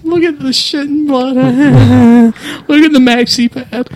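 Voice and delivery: high, slow, laughing through the words; cracking up while pointing it out. Real, not acted. Laughing at gross stunt clip.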